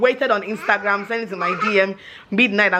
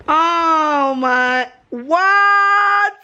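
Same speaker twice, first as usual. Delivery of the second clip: high, loud startled what; surprised; 9 semitones higher, 4 dB louder, much slower than usual. Real, not acted. Wife spotting the husband's birthday gift, surprised. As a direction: gasp it out at the sudden gift.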